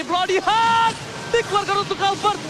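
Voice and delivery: loud, rising esports shout; fighting game announcer. Acted. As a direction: call the teamfight like an arena announcer. Sudden intense fight call. (penyiar pertarungan yang berteriak heboh)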